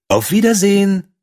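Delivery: hoher, heller Vogelabschied; Schwalbe. A narrator's reading. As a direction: zum Abschied hoch hinaufrufen. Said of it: "sagte die Schwalbe". (hohe Abschiedsstimme der Schwalbe)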